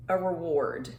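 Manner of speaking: usual speaking voice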